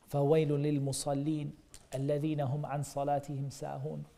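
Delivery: rapid recited Arabic verse; تلاوة سريعة; Quran recitation. Acted.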